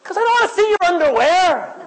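high, loud amen with a laugh; laughing high shout of agreement; excited amen. Real, not acted. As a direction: cheer it and laugh at the end. Preacher cheering, congregation agrees.

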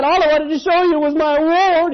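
put-on high, loud wail of a hurt child; a kid about to burst into tears; tearful little boy. Boy crying that he only wanted to show his award, high and loud. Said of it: "He said".

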